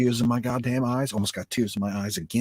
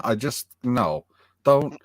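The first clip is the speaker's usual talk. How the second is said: imitated plea; 5 semitones higher, 5 dB louder, slower than usual. Pleading with the show.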